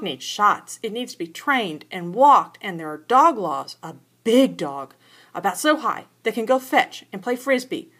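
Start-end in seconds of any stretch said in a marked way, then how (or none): none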